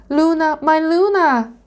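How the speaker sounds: high, loud desperate cry; screaming for help. A narrator's reading. Dragonling screams for Luna as he feels a pull, desperate. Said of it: "Firion screamed".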